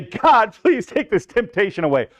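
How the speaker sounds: mock pleading prayer. Preacher imitating a believer begging God, mock pleading. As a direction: beg God like a tempted believer, half mocking.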